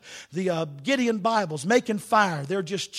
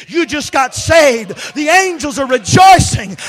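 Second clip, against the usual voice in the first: much louder, higher rejoicing shout; jubilant shout; 4 semitones higher, 15 dB louder than usual. Retold or imitated. Preacher celebrating a new convert, shouting for joy. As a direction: shout the good news to the heavens.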